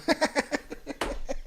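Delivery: stifled, mostly breathy chuckle; wheezy laugh without words. Real, not acted. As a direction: crack up at a garbled sentence. Cracks up at the comment.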